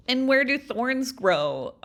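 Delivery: drawn-out, slow quiz-show question; slow teacherly question. Pretend. Crafter posing a mock quiz to viewers, slow and drawn out. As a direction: stretch it out like a teacher.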